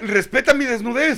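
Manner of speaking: high, loud laughing protest; mock indignant, laughing. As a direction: demand respect while cracking up.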